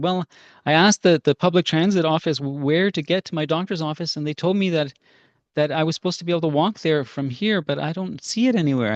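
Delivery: high-pitched imitation of an old lady; old woman. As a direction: play a lost old lady explaining herself. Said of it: "this old woman knocks on my door".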